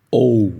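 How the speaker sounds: O said like calling out to someone; English letter O. Shown as a demonstration. Teacher demonstrates the letter O, like calling a person. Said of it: "É como se você estivesse chamando alguém".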